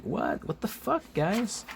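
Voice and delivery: high-pitched mock disbelief; Venom. Venom protesting as Firestar pulls back, high incredulous voice. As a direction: squeak upward in comic protest. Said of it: "Venom is like".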